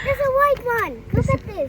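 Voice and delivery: excited. Real, not acted. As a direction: point it out eagerly.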